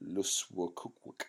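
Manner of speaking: acronym luswcwc spoken slowly as one word; acronym read out. Shown as a demonstration. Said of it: "if there was an acronym".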